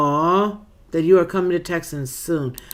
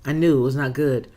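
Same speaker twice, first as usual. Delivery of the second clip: ordinary talk, nothing marked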